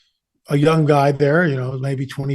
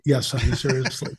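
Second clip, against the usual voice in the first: dry; 2 semitones lower, 5 dB quieter than usual. Retold or imitated. His deadpan answer to wife.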